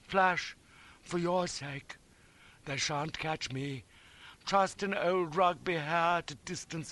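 high, slow fervent tenderness; slow, emotional promise with solemn pauses; noble farewell. A narrator's reading. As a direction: vow earnestly, swelling with heroic feeling. Noble farewell to the injured friend.

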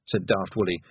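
ordinary delivery, nothing marked

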